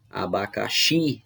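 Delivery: "Abacaxi" slow, with a drawn-out final "xi"; stress on XI. Shown as a demonstration. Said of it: "sílaba tônica seria o xi". ("Abacaxi" devagar, com ênfase no "xi")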